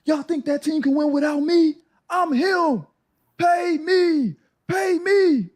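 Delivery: high, drawn-out boastful yelling; imitating a crazed rant. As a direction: act out a star player going crazy at the gym. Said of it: "Jimmy going crazy".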